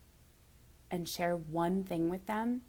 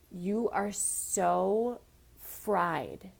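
very slow; much slower than usual. Real, not acted. Tells younger self she's exhausted.

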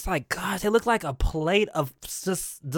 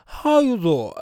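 drawn 'doing' falling into a rounded 'zaw'; Wendy Williams; 2 semitones higher, 3 dB louder, slower than usual. Retold or imitated. Host imitating talk-show host's real way, slurred catchphrase. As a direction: slur 'doing' with dropped lips. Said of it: "She's gonna be saying".

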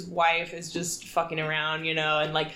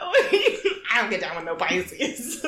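quote through laughter; 2 semitones higher, slower than usual. Retold or imitated. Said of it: "so funny to say that".

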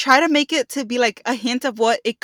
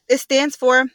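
normal talking voice

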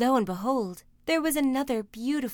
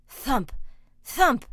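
single pounding words, spaced out and mounting; thumping footsteps; 6 dB louder, much slower than usual. A narrator's reading. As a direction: build the thuds as the giant nears. Narrator imitates ogre's approaching footsteps.

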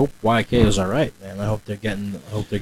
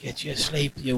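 put-on old prospector; 4 semitones higher, 12 dB quieter, slower than usual. Old man scolding kids.

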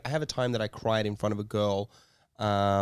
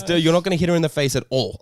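laughing through the quote; 5 semitones higher, 6 dB louder, faster than usual. Retold or imitated. Laughing while retelling dad's advice.

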